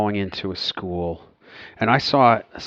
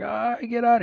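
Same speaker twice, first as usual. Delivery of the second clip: imitated distress; 14 semitones higher, slower than usual. Someone wounded pleading in the library, distressed. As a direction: imitate a pained plea at high pitch. Retelling a victim during the shooting.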